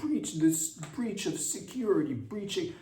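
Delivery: a hint of breathless panic; mocking the news; mock news alarm. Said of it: "a lot of news, like, just be like".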